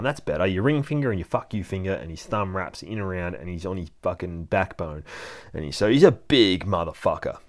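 usual speaking voice

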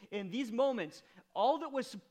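preacher urging to embrace grief; building sermon. Acted. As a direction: build to full preaching force.

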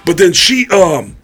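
a lightly voiced sheepish guy confessing; guy on Cops confessing, lightly voiced; imitating the guy. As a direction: admit it with a lame excuse. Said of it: "the guy goes".

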